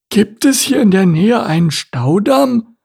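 high-pitched surprised question; high character voice, taken aback; surprised turtle. A narrator's reading. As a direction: ask in startled surprise as the turtle. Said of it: "fragte Larissa überrascht".

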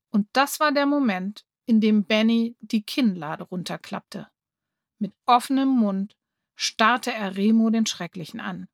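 usual speaking voice

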